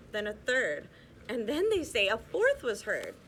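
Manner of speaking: lilting read-aloud storybook voice; cosy bedtime storyteller, rhythmic; story narration. A narrator's reading. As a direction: read the rhymes to children, bouncing the beat. Reads rhyming picture book aloud.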